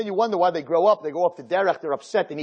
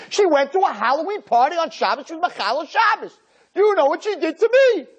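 mock aggrieved teacher; 10 semitones higher than usual. Teacher venting to the rabbi, high and building. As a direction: build to outrage.

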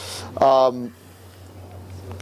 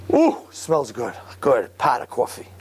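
savoring aroma; 2 semitones lower, slower than usual. Retold or imitated. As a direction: breathe it in like good coffee. Rebbe savors coffee.

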